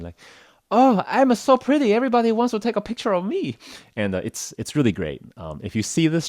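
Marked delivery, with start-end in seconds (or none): 0.7-3.6 put-on very high, self-admiring girly voice; delighted kimono girl. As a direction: gush about yourself in a squeaky put-on voice. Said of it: "Chinese kimono girl will be thinking".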